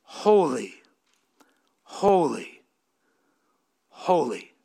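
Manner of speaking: hushed, falling Holy, holy, holy; Holy, holy, holy said low and quiet; soft solemn holys. Shown as a demonstration. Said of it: "Notice in verse three".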